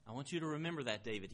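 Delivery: ordinary talk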